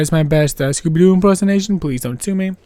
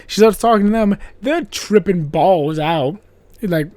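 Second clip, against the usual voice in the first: usual speaking voice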